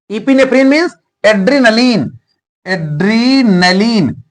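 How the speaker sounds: normal talking voice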